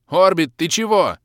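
loud, high bewildered call; puzzled shout. Acted. Hero puzzled at weeping elf, loud shout. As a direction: shout in confusion at the crying elf. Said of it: "С недоумением крикнул я".

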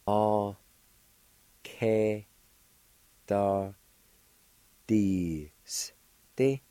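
"A, K, da, D, S, T" with a long pause after each syllable. Shown as a demonstration. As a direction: sound out "A, K, da, D, S, T" in separate beats. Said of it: "It's pronounced like this".

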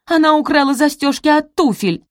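high, loud indignant squeal; shrieking accusation. A narrator's reading. Araminta accusing Sophie before the judge, shrieking. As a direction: screech it out in outrage. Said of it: "завизжала Араминта".

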